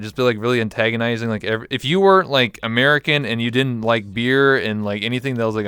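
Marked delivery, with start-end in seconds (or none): none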